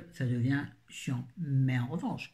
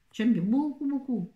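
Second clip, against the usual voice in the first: high, eager gushing; 8 semitones higher, 4 dB louder than usual. Real, not acted. She tells viewers she loved the book, gushing. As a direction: stretch the beaucoup with delight. Enthusiastic verdict.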